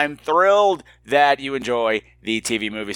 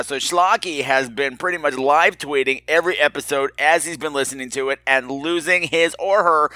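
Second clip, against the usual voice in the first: ordinary delivery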